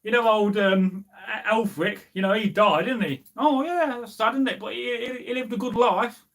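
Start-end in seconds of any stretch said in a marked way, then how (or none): 3.3-6.2 high, lightly voiced sorrowful old man; a hint of a mourning villager; sympathetic old man. Warrior in mead hall hearing Elfric died, mild mock sorrow.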